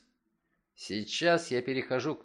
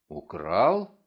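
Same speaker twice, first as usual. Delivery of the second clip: lower, loud stunned question with a rise; shocked echo; 6 semitones lower, 5 dB louder than usual. A narrator's reading. Son backing away from his accusing father, shocked. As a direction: recoil at the accusation. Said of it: "попятился он".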